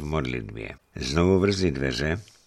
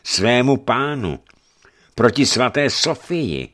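high, loud, building indignation; angry bishop's rebuke, raised voice; angry accusation; 9 semitones higher, 6 dB louder than usual. A narrator's reading. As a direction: accuse him wrathfully, phrase by phrase. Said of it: "začal hněvivě Eufemii".

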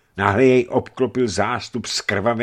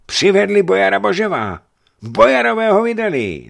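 very high, loud cries; excited shouting; 13 semitones higher, 10 dB louder than usual. A narrator's reading. The crowd cries that the boyar is brought, excited shouting. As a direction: spread the news in a yell.

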